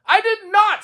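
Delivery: high, loud denial; protesting Toad. A narrator's reading. Toad denying he did well, high and loud. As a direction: insist you didn't.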